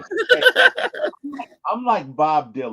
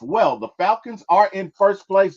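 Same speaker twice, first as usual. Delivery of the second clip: ordinary delivery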